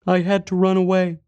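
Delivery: put-on high, small child voice, a touch sad; sad little boy. A narrator's reading. Boy recalls fleeing the monster, sad child voice. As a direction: repeat it sadly as a young boy. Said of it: "he repeated sadly".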